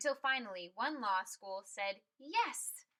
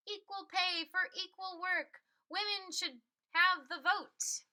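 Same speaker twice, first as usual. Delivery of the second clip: Belva's rallying cry; 7 semitones higher than usual. Acted. Belva announcing her cause to the nation in a raised, slowed rallying voice.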